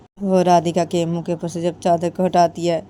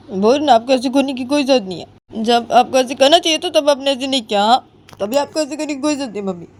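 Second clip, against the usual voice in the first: put-on high, aggrieved young woman's voice; hurt daughter-in-law; 6 semitones higher, 5 dB louder than usual. Daughter-in-law reproaches mother-in-law, high and hurt. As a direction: reproach her for raising a hand.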